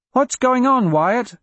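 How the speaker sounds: put-on high, questioning child calling out; small girl's voice. A narrator's reading. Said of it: "a small voice called".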